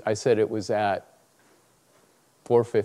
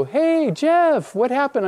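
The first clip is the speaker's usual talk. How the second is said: high-pitched imitation of friends calling; acted cheery shout-out, pitched way up; 12 semitones higher, 4 dB louder than usual. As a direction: hail a friend in a high, eager voice. Friends calling out after the fight.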